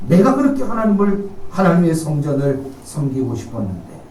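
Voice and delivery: ordinary delivery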